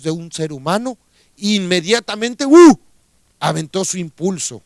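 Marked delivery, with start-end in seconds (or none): 2.5-2.8 a sharp, loud, much higher yelp; sudden outburst. Acted. Preacher voicing the hermit's impulsive lash-out as a sharp cry.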